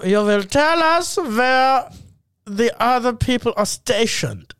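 slow, menacing interrogator voice; a hammed-up German officer demanding answers; stern villain from an old war comedy; mock Nazi officer.

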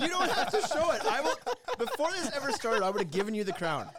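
high, giggly protest through laughter; laughing while talking. Real, not acted. Laughing through hot sauce teasing.

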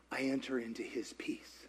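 sigh, then very soft and low; peaceful hush. Real, not acted. Preacher describing entering God's peace, sighed and soft. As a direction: breathe out into calm.

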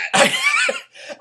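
squealing laugh bursting out; high burst of laughter. Real, not acted. Friend's absurd story.

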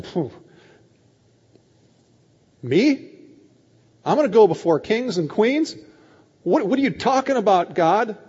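loud, high disbelieving questions; acted shocked protest; incredulous. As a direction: balk in astonishment. Acted disciples reacting to Jesus' words.

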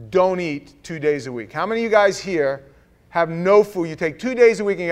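challenging; loud. Real, not acted. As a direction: call out the crowd. Preacher challenging congregation.

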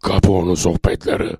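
low announcer voice for a show title; live jingle read. Shown as a demonstration. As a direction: deliver it as a deep radio ident. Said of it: "bir tane canlı jingle çekelim". (pes sesle jingle anonsu)

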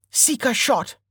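high, forceful battle call; calling out a move. A narrator's reading. Archer naming his skill as he shoots, battle call.

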